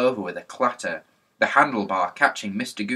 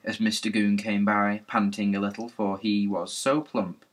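plain reading voice

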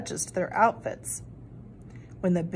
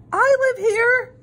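much higher, louder and drawn out; desperate cry; 14 semitones higher, 6 dB louder, slower than usual. A narrator's reading. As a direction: call out so the prince will hear. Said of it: "cried Chickenrella".

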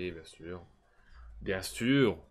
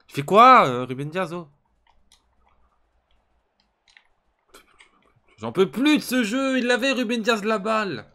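cri très aigu et fort; hurlement exaspéré; 10 semitones higher, 11 dB louder than usual. Real, not acted. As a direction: explose contre le défenseur. Défenseur rate la balle. (hurle de frustration)

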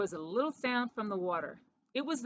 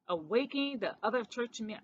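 plain reading voice

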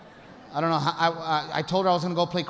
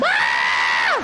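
mock scream; 13 semitones lower, 6 dB louder than usual. Shoppers finding free stuff at Macy's, shrieking. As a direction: let out a joyful shriek. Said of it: "your vaginas would scream".

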